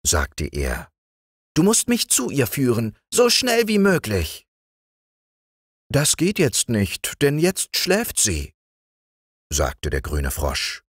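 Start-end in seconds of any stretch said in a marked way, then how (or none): 1.5-4.5 impatient little frog prince; demanding frog prince. Acted. 5.9-8.5 high, slow frog voice; the patient green frog; calm green frog. Acted.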